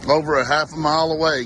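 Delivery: ordinary delivery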